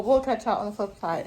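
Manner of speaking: ordinary talk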